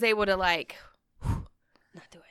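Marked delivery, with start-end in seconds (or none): 1.0-2.2 soft unvoiced whisper, slow. Real, not acted. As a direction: breathe it out in a whisper. Sighs, whispered aside.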